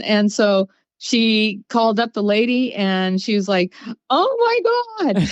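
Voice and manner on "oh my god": a sudden high "Oh my God", much higher; retold gasp of surprise. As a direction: squeal it like a stranger who just got her purse back. Lady finds her lost purse.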